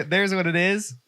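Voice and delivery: ordinary talk, nothing marked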